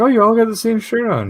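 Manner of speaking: high, loud mother voice; a friendly mom noticing the matching shirts; chatty mom. Retold or imitated. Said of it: "she's like".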